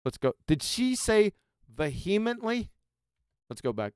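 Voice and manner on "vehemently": VEHEMENTLY stressed on the second syllable, ve-HEE-mently; wrong-syllable stress. Retold or imitated. Host quoting a guest's misplaced stress, incredulous ve-HEE-mently for VEHEMENTLY. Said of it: "wrong emphasis on the wrong syllable".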